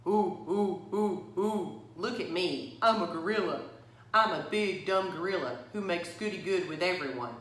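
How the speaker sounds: a kid's mocking gorilla grunts and jeers; hammed-up bully playing a dumb gorilla; taunting gorilla. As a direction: ape around to tease the group. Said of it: "grunting and scratching his armpits".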